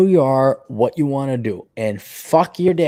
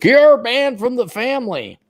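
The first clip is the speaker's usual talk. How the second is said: strict parent yell; 10 semitones higher, 4 dB louder than usual. Retold or imitated.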